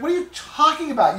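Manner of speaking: high, exasperated challenge; incredulous. Retold or imitated. Host retelling his challenge to the excuse, incredulous. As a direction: pitch it up in disbelief.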